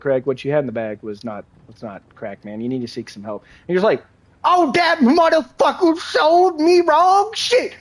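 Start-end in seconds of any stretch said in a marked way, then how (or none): 0.0-3.4 much softer, lower, earnest; quiet serious advice. Retold or imitated. Serious talk to Craig. 4.4-7.7 very high, slow indignant whine; high outraged junkie. Retold or imitated. Craig outraged at dealer.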